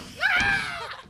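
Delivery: high bright burst of laughter; happy pose laugh. Pretend. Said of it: "striking a happy pose".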